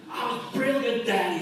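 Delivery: put-on high, boastful little boy voice; proud kid. Young Stevie boasting to his dad, high kid voice. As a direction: crow like a proud schoolboy. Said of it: "he said".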